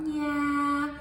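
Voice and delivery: "yeah" high and drawn; trailing yeah. Shown as a demonstration. Teacher showing me'ek: trailing "yeah". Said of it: "yeah, dot, dot, dot".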